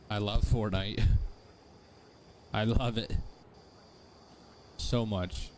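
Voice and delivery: deadpan mock devotion to a game; sarcastic. Streamer feigning love of Fortnite to viewers, sarcastic. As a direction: gush sarcastically, keeping the voice level. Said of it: "That was me being sarcastic".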